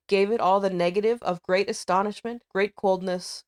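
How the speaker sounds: usual speaking voice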